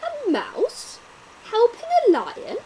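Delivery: loud, high disbelieving lion voice; a big lion mocking the tiny mouse; scoffing lion. Lion scoffs at a mouse offering help, loud and high.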